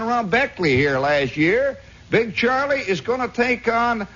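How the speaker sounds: high, carrying ringmaster call; hammed-up country fight announcer; pit announcer. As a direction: announce the bout to the whole stands. Acted cockfight announcer addressing the crowd.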